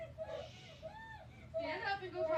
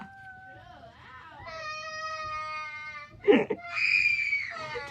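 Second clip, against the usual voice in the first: long, loud laughing fit, much higher; helpless laughter; 12 semitones higher, 8 dB louder than usual. Real, not acted. Parent laughing helplessly, near tears. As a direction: laugh until it nearly turns to tears. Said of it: "why am I laughing".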